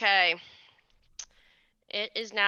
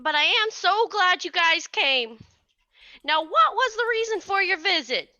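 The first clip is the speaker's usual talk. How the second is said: put-on sweet old lady; 7 semitones higher than usual. GM continues the midwife voice.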